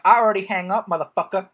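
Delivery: hammed-up angry kid; mock snapping daughter. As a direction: daughter hanging up on dad. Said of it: "Why are they all saying motherfucker".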